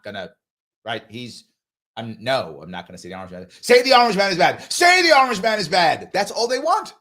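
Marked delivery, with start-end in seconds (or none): 3.5-6.1 mock demanding interrogator. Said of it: "This is what they're gonna say".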